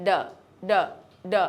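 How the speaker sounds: ড said soft and light, barely touched. Shown as a demonstration. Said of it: "হালকা করে ডি উচ্চারণ করতে হবে".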